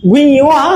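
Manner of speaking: "ভুইয়ো আ" with a separate "yo" before aa; ঞ wrongly spelt out. Shown as a demonstration. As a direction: break it up as "ভুইয়ো আ", sounding the letter's name. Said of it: "এরকম বলছি".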